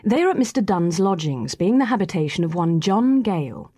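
plain reading voice

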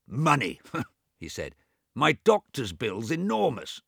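lightly voiced grumbling old man; a hint of the cranky invalid; mild testy old Swithin. A narrator's reading. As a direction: grumble it half to himself. Swithin scoffs at money talk.

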